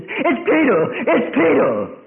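loud, high excited cry; excited Rhoda. Acted. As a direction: blurt it out, thrilled. Rhoda rushes back excited.

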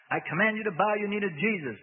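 commanding the demon to bow; firm command. A narrator's reading. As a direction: order it with full authority. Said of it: "Bob said firmly".

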